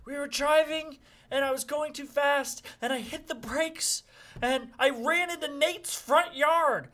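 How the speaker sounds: high-pitched mock-tearful confession; put-on hysterical confession. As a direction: act overwrought, pitch held high throughout. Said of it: "I'm sobbing and screaming".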